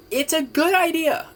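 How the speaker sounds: mock high voice. Repeated approving aside, pitched way up.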